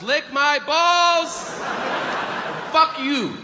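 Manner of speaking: very high, yelled-out blurt; Tourette's outburst. Acted. Kid interrupting the Bible reading in church, high involuntary outburst. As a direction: burst out high, stretching the words.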